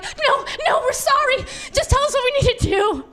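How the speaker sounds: put-on very high, pleading kid voices; panicked kids. Kids pleading with their mother, high mock panic. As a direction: beg like frightened children. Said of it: "all the kids are like".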